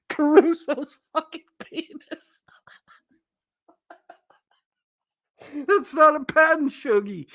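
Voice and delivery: high-pitched, laughing through the words; barely getting words out past laughter; laughing hard. Real, not acted. Streamer cracking up at chat.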